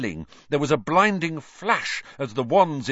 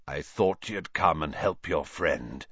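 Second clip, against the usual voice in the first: low, rusty hoarse voice; 7 semitones lower, 4 dB quieter than usual. A narrator's reading. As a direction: speak like a voice long unused. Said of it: "he said hoarsely".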